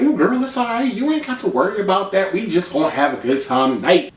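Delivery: lightly mocking girlfriend talk; mock Tammy. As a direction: reassure her like a friend. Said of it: "And Tammy like".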